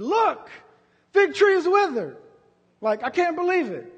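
high, loud astonished cry; amazed Peter. Retold or imitated. Preacher voicing Peter, loud and amazed. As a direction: Peter gasping at the withered tree. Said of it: "And, and Peter said".

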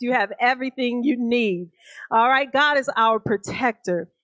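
normal talking voice

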